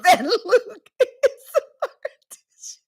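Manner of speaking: high voice collapsing into laughter; cracking up. Real, not acted. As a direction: lose it laughing mid-sentence. Joking through laughing fit.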